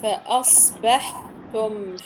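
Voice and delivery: very slow "فأصبحتم", broken around a held breathy ح; slow model of "فأصبحتم"; tajweed demonstration. Shown as a demonstration. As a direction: spell out the sounds of "فأصبحتم", lingering on the unvowelled ح. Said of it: "حرف الحاء الساكن".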